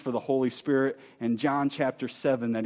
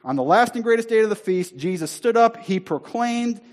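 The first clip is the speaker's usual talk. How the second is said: high, loud proclamation; shouting out; 8 semitones higher, 6 dB louder than usual. Acted. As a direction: announce it to the whole crowd. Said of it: "He's shouting out with the crowds".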